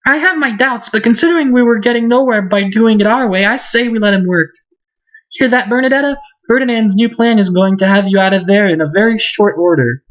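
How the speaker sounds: high-pitched, confident character voice; game girl rallying. Acted. Girl warns Bernadetta of Ferdinand's plan, high confident voice.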